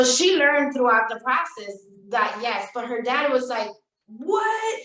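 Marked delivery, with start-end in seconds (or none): 4.1-4.8 shocked what. Retold or imitated. Dad's shocked reaction.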